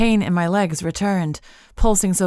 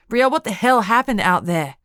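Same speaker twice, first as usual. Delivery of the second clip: angry demand; 4 semitones higher, 5 dB louder than usual. Acted. Angry friend confronts her.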